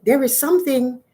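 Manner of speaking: little fish. Acted. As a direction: speak up as a helpful little fish. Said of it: "the fish said".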